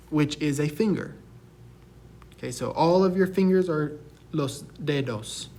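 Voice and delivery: ordinary talk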